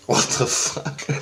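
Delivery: breathy, drawn-out retold gasp of disbelief; slow, voiceless reaction of shock; stunned disbelief. Stunned reaction to spirit's answer.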